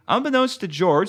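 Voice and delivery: plain reading voice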